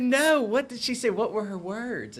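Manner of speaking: high, insistent questioning; urgent woman's voice. Retold or imitated. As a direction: press her for the exact words. Lady pressing for the words.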